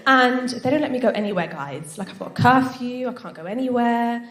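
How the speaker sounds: ordinary talk, nothing marked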